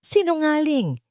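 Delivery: high, crying out; shouting liar. A narrator's reading. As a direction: hurl the accusation back. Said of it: "sigaw ni Alice". (sigaw na may iyak)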